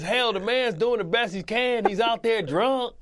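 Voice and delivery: high, loud mock coach, slowing on drunk; hammed-up coach defending a drunk player; retelling the coach's absurd halftime speech; imitating coach Singletary. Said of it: "he says".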